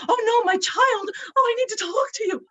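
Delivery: high, hurried pleading of an overbearing mom; frantic clingy parent. Retold or imitated. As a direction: ham up a parent chasing after their grown child. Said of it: "instead of a parent who's saying".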